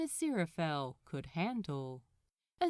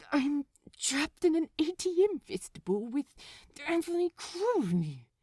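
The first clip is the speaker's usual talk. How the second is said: plain reading voice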